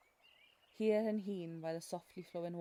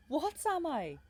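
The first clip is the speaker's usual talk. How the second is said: indignant; 10 semitones higher, 8 dB louder than usual. Acted. Guide mocks the listener's question.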